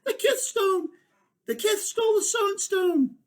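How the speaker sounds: high, loud alarmed cry; high-pitched alarmed shout. Acted. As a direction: shout the theft to everyone. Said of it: "someone shouted".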